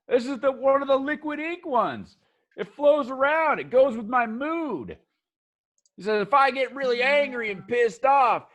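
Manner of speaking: eager shop guy. Acted. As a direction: sell them on piercings and ink. Kip explains mood tattoo.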